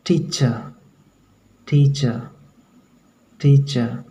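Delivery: टीचर ending in "chuh", the R dropped; silent final R. Shown as a demonstration. Teacher drills example word for students, silent final R. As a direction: let टीचर fall away on an open vowel. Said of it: "र last में है लेकिन वो sound आता नहीं".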